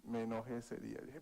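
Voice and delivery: usual speaking voice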